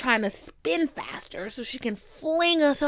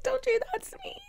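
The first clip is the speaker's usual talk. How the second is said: whiny plea; 6 dB quieter, faster than usual. Retold or imitated. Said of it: "I'm like".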